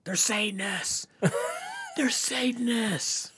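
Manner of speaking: high, slow mock panic, drawn out; hammed-up terror of a fleeing camper; panicked scream.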